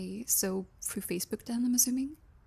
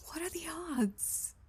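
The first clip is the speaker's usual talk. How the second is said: whispering; 7 semitones higher, 6 dB quieter than usual. Acted. Whispered aside.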